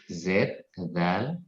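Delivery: ordinary delivery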